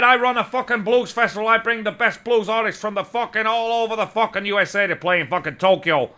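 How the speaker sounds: put-on furious, high-pitched shouting in an Italian New York accent; Italian New York rant. Blues promoter threatening the band, shouting in Italian New York accent. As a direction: imitate an enraged New Yorker at full volume. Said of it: "a kind of an Italian New York accent".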